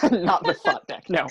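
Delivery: laughing echo of a slip; tickled, laughing as you say it; amused, laughing. Real, not acted. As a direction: repeat it through a laugh.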